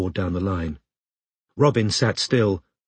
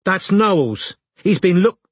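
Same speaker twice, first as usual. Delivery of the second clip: high, raised woman's voice, cut off; louder, high-pitched telling-off; much louder; 9 semitones higher, 4 dB louder, slower than usual. A narrator's reading. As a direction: scold a child loudly, breaking off mid-word. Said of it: "and then, much louder".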